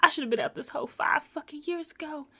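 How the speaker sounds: exasperated crying. Retold or imitated. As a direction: voice Mercedes near tears. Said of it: "she's, like, exasperated and she's, like, crying".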